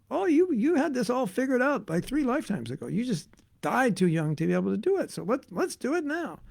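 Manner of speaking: lightly high, coaxing thought; the thought replying. Retold or imitated. An old thought urging its owner to act, lightly high. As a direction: nudge like a pushy old thought. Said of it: "the thought answers".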